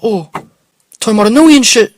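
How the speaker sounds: high, loud and pushy; loud street stranger. Retold or imitated. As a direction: fire the question up high and loud. Stranger guesses his town.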